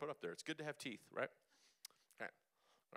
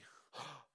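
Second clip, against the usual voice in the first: quick gasp; 3 semitones higher, 8 dB quieter than usual. Shown as a demonstration. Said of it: "Do that really fast".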